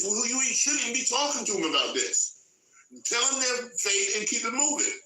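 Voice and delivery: high, heated preacher's exhortation; preaching at full force. Acted. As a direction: rise up and hammer the point home. Preaching climax, pitch jumps high.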